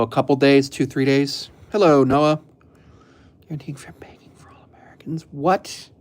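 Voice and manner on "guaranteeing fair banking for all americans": fast, unvoiced whisper of a headline; whispered reading. Real, not acted. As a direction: read it to yourself under your breath. Reads headline under breath.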